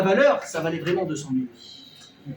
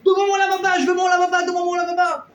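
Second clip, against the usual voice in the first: high, loud, desperate yelling; frantic shouted demand; 10 semitones higher, 7 dB louder than usual. Acted. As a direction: bang on the door and yell for it back. Marido exige de vuelta su lavaba.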